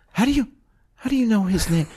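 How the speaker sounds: high-pitched, halting bewilderment; acted dawning disbelief. Producer realizing he has been betrayed, acted bewilderment. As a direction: stammer it out high, piecing it together.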